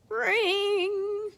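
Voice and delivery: high, bright ringing cry of the bell. A narrator's reading. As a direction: sing out the bell's ring. Bell ringing sound effect.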